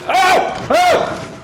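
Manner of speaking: very high loud startled cries; startled scream. Real, not acted. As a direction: shriek in fright. Jump scare in horror game.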